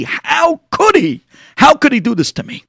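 high, indignant outburst; seethe, then burst out in outrage; boiling with anger. Acted. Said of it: "he was boiling".

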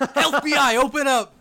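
high, loud pounding-door shout; laughing mock raid shout at the door; mock FBI raid yell. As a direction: bellow it like cops at the door.

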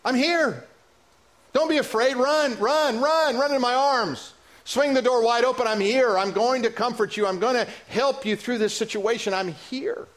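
high-pitched, earnest call to come running; God's welcoming call. Acted. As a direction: preach it as God calling a child home. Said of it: "he's saying".

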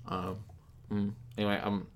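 talking while eating dinner; words around a bite of food; mouth full. Real, not acted. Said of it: "I'm also eating dinner as we're going".

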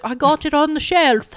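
put-on hammy, poor Australian-ish accent; bad imitated accent. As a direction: have a clumsy go at an Australian accent. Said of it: "That's my accent. It's bad".

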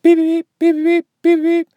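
high, quick beep beep beep like a timer; imitating watch beeps.

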